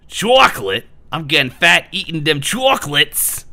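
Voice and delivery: over-the-top loud character read; put-on chocolate lover. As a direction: belt it out, relishing the chocolate. Performs quiz option in put-on voice.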